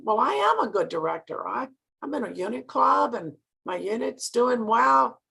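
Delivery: mock-indignant, with a swoop up on "am"; mildly defensive protest. Director inwardly answering her mentor, mock-defensive. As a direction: insist on your own credentials, half in fun.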